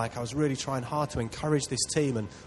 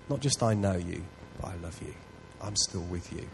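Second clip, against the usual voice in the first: tender; 6 semitones lower, 7 dB quieter than usual. Retold or imitated. God reassuring someone in their darkest moment, soft and low. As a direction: speak it softly like a whispered promise.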